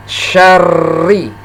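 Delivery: SYARRI with a spread sh and long rolled r; spread sh, doubled r. Shown as a demonstration. Teacher having learners repeat, spread sh and pressed r in syarri. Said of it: "mulut kita agak".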